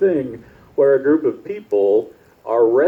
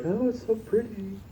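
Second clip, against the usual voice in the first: mock dreamy; 10 semitones higher, 9 dB quieter than usual. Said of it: "we're like".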